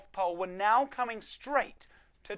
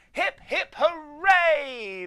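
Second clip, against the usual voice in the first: high, loud cheer; 9 semitones higher, 10 dB louder, much slower than usual. Acted. Elves cheering the saved Christmas, high loud cheer. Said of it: "the elves all cheered".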